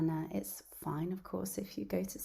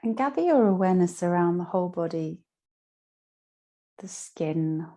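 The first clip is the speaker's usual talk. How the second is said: guided meditation; 10 dB louder than usual. Acted. Yoga teacher guiding listeners lying down through Yoga Nidra, guided meditation. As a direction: guide them down into rest, phrase by phrase.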